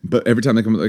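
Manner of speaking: ordinary talk, nothing marked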